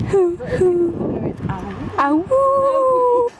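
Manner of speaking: high mock wolf howl. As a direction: howl at the moon. Howling at the full moon.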